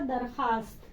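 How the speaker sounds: "दरखास्त" with the vao left silent. Shown as a demonstration. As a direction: say "दरखास्त" with plain khaast, no w. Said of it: "वाव जो लिखते हैं लेकिन पढ़ेंगे नहीं".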